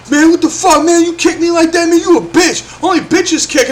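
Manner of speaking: mock aggrieved complaint; put-on indignant whine; retold indignant protest. Retold kicked boy protesting.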